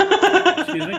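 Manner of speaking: high, loud, cracking up; laughing mock retort. Host mimicking partner's claim on the house, laughing.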